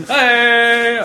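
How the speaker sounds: sudden high yell; playful shout. Real, not acted. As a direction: bark out a silly hey. Sudden outburst, laughing.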